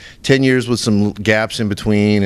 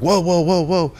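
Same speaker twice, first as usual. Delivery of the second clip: startled whoa; 9 semitones higher, slower than usual. Retold or imitated. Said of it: "they're like".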